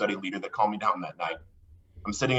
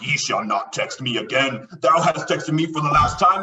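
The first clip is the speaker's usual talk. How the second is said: higher, louder solemn biblical decree; King James English; 4 semitones higher, 4 dB louder than usual. Retold or imitated. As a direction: pronounce it like scripture. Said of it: "in, like, King James".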